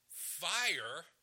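"Fire" thrown out as a loud, high announcement; emphatic fronted word. Shown as a demonstration. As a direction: FIRE punched high and loud, standing alone. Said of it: "He's emphasizing that it's fire".

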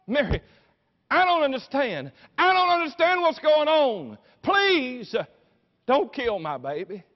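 high, loud desperate cry; anguished pleading. Acted. Mother begging for her baby's life, anguished shout. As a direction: beg at full voice, easing off at the end. Acting Mary pleading at the cross.

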